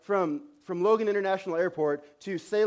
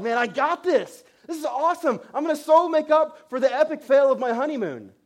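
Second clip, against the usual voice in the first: excited; 9 semitones higher, 7 dB louder than usual. Retold or imitated. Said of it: "So I was like".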